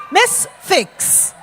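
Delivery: ordinary delivery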